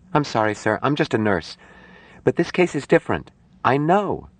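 high woman's voice, apologetic then insistent; defer, then press the point; earnest young nurse. Acted. Nurse objects to the doctor's treatment, earnest.

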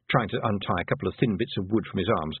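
ordinary delivery, nothing marked

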